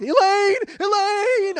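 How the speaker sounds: very high, loud drawn-out cry; desperate movie yell. Acted. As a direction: wail the name as if pounding on glass. Reenacts the Graduate's desperate window scene.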